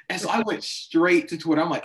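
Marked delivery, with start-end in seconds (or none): none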